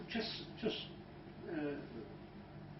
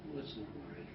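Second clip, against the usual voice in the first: hypnotic calm voice; 6 semitones lower, 16 dB quieter than usual. Shown as a demonstration. Hypnotist guiding a client's breathing, very soft. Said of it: "Just breathe".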